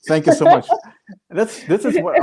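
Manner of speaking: high, laughing through the thanks; real laughter breaking into the words. Real, not acted. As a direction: thank them while cracking up. Laughing thanks for a troll comment.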